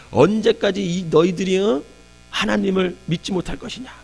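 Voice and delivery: scolding them with real heat; angry rebuke. Retold or imitated. Moses rebukes the grumbling people, angrily. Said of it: "모세가 화가 난 거야".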